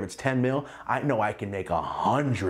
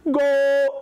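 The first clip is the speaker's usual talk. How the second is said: high, loud, held goal call; long loud goal cry; soccer announcer; 7 dB louder than usual. Retold or imitated. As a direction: belt it like a stadium commentator. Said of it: "Like a fucking soccer announcer".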